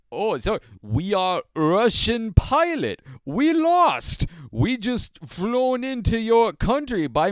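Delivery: loud, high, slow hammed-up bad Russian accent. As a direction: ham up a deliberately bad Russian accent, pilot faking it. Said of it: "bad Russian accent".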